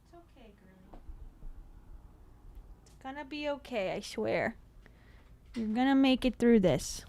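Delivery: hushed comforting aside to a dog; soft reassurance. Real, not acted. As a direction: soothe the dog quietly.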